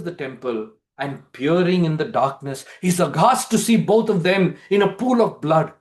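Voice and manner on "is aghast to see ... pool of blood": usual speaking voice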